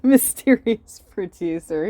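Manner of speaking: sarcastic slow mystery voice; mock dramatic question. Real, not acted. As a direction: ham up the mystery, laughing. Mocking the fired producer story.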